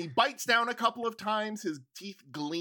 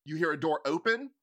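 normal talking voice

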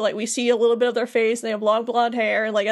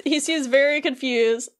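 normal talking voice

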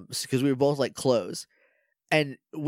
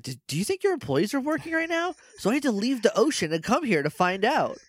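high mock boss voice; 7 semitones higher than usual. Boss quotes the fish to staff.

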